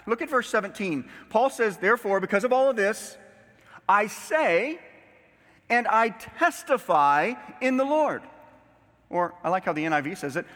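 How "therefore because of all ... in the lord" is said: louder, higher, weighty declaration; emphatic scripture reading. Acted. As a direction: proclaim it as solemn testimony. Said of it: "don't miss that this is emphatic".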